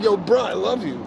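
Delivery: high, lightly voiced fake affection; fake friend. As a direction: gush it up high, insincere.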